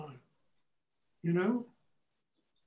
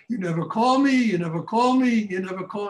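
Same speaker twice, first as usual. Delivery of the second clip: mock mother harping on her son, raised voice; nagging mother; 2 semitones higher, 6 dB louder than usual. Mother's complaint repeated, more heated.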